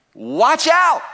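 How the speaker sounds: high shouted warning; loud warning. Acted. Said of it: "And he said to them".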